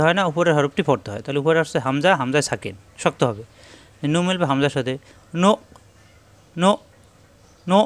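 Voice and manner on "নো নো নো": নো ended by a hard hamza catch in the throat; stopped in throat. Shown as a demonstration. Teacher drills students on নো with a throat stop. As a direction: clip নো shut at the throat, no bounce. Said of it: "গলার ভিতরে আটকে যাবে".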